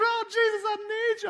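very high, wailing mock sob; mock bawling. Retelling his childhood prayer to Jesus, mock bawling. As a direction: cry out a hammed-up tearful plea. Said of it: "I'm just crying everywhere".

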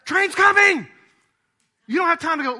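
high, loud alarmed shout with a laugh; urgent warning shout. Pretend. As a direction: yell it like the train is right there.

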